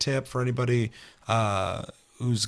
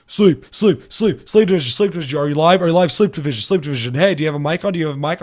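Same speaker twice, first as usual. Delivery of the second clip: high, loud, manic mic check; rapid loud calls of sleep division; loud goofy stranger; 5 semitones higher, 9 dB louder, faster than usual. Retold or imitated. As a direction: shout it at him like an excited stranger. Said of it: "He's like".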